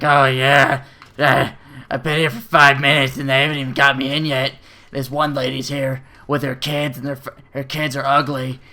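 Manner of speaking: put-on voice of a griping woman on the phone; mocking imitation of a constant complainer; complaining lady. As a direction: gripe in a mocking caricature of a whiny customer. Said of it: "she just starts fucking bitching about everything".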